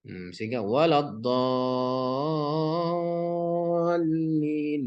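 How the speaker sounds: "waladdallin" drawn out very slowly, each sound stretched long; wrong recitation. Shown as a demonstration. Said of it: "Kan nggak boleh waladdallin". ("waladdallin" dibaca sangat panjang dan lambat, contoh bacaan yang salah)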